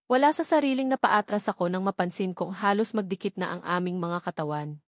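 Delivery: usual speaking voice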